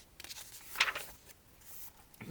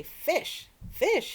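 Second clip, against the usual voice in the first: loud, high angry shout; angry host shouting; 12 semitones higher, 8 dB louder than usual. A narrator's reading. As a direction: yell the word out. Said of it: "he shouted".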